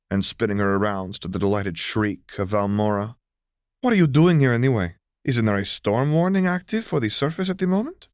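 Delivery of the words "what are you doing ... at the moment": much higher, louder amazed questions; surprised question. Acted. Zariah surprised to see Valmora, amazed questions. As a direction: ask in happy disbelief.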